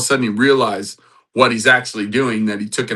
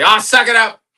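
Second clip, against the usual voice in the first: high, loud dismissive jibe; mock impatient; 13 semitones higher, 9 dB louder than usual. Preacher jokes what Jesus didn't say to Peter, mock impatient. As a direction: throw it out as a mock scolding.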